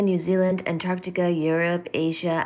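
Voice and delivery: normal talking voice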